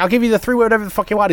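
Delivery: high, quick pleading little leprechaun. Retold or imitated. Leprechaun bargains, voiced high.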